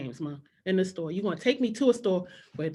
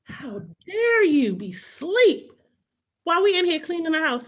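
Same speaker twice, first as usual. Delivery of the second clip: mock outrage; 8 semitones higher, 5 dB louder than usual. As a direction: drag out the indignation. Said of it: "I was like".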